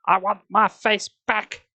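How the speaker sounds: high, slow raging shriek; high slow furious shriek. Acted. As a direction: shriek it out of control. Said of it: "she shrieked".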